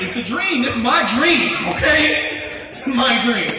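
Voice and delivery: loud, high, insistent complaint; indignant protest. Acted. Dreamer objecting to the Ranger he became, indignant. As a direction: argue it hard, pitch up.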